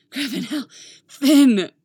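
loud gasping cry of a name. Acted. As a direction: cry out, breathless and overwhelmed. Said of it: "And Poe gasps".

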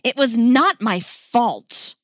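loud, high, slow denial; indignant, drawn-out self-defence; hissed protest. Acted. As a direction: insist on innocence, exasperated. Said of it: "Dick hissed".

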